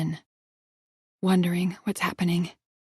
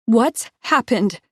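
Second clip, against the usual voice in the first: slow loud shout, higher; 4 semitones higher, 7 dB louder, much slower than usual. A narrator's reading. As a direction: drag the words out in horror. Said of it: "I shout".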